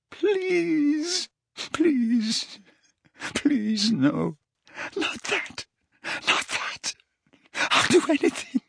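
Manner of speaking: high wail breaking into whispers; thin, tearful whimpering; anguished pleading. A narrator's reading. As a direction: beg in terror, voice failing. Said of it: "screaming again".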